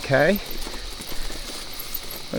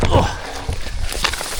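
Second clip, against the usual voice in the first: ordinary talk, nothing marked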